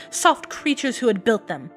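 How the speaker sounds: usual speaking voice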